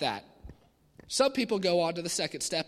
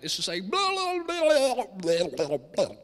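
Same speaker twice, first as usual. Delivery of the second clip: mock blah-blah babble; 3 semitones higher, 3 dB louder, slower than usual. Shown as a demonstration. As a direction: spill out meaningless babble like word vomit. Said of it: "what I like to call word vomit".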